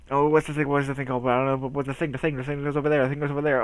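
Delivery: running monotone, words tumbling in a loop; mumbly, repetitive self-mockery; rambling word search. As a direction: babble on hunting for a word you've lost. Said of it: "ends in me going".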